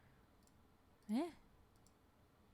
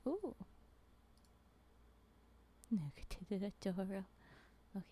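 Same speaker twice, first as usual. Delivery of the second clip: normal talking voice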